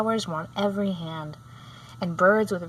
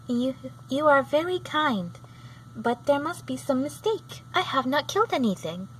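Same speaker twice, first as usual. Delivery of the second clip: hesitant; 6 semitones higher than usual. A narrator's reading. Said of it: "with hesitation".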